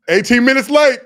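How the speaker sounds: loud, high holler; calling out. Real, not acted. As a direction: holler it at the latecomer. Calling out the late co-host.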